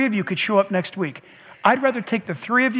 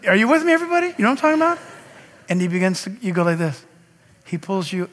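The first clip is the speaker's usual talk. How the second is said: normal talking voice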